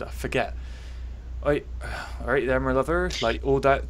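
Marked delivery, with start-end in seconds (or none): 1.4-3.1 Bristolian accent. Shown as a demonstration. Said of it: "How does that sound?".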